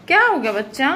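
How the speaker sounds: cooing to the dog. Real, not acted. Asking the sick dog.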